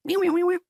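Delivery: high-pitched imitated squeal; mimicked playback noise. Imitates what keeps playing.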